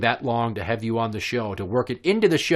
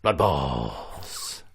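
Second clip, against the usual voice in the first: deep movie title voice; low, growled title announcement; trailer title drop; 8 semitones lower than usual. Pretend.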